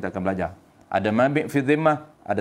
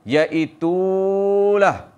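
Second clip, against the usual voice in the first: "Iaitulah" very slow and higher; Tok Guru intonation; 7 semitones higher, 4 dB louder, much slower than usual. Shown as a demonstration. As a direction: hold "Iaitulah" out long on a raised note. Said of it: "Suara Tok Guru tadi".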